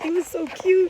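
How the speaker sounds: high, loud gush of delight. Real, not acted. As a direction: squeal it with delight. Gushing over the bunny.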